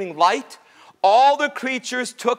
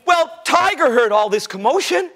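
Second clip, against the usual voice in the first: plain reading voice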